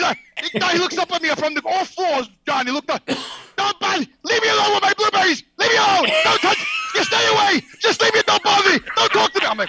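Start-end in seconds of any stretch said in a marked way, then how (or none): 3.6-9.5 put-on frantic old man. Herb on his knees begging the shopper to keep away. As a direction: beg to be left alone with the berries.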